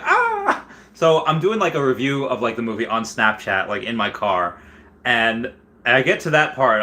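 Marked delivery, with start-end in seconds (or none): 0.0-0.7 mock gasp. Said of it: "I was like".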